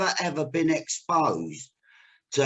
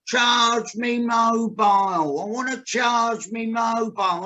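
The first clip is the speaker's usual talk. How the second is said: slow English-abroad speech; 4 semitones higher, slower than usual. Retold or imitated. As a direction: stretch each word slowly, as if that translates. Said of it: "speaking slower in an English accent".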